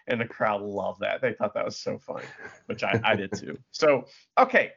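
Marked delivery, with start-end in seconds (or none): none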